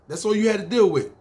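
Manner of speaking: normal talking voice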